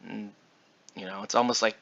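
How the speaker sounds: usual speaking voice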